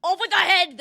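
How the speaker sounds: loud, high roared command. A narrator's reading. Prince ordering the sister's execution, roared. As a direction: roar it out as an order. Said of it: "the prince roared back".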